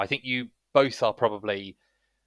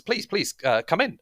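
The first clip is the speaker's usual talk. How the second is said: higher-pitched polite host; welcoming young man; 9 semitones higher, 3 dB louder than usual. Acted. Young man ushers travellers inside, eagerly polite. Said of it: "as he ushers you in".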